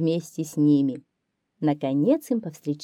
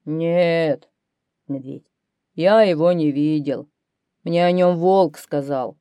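bear's answer; 5 dB louder than usual. A narrator's reading. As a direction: answer the lion as a big bear. Bear answers the lion. (голос медведя)

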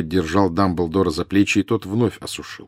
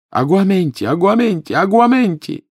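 frantic shouting; 12 semitones higher, 4 dB louder than usual. Acted. Frantic repeated spell casting.

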